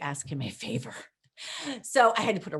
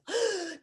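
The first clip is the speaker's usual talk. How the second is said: sharp high shocked gasp; 13 semitones higher than usual. Retold or imitated. Hearing the flag was hung wrong, shocked gasp. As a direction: gasp in alarm. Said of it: "I'm like".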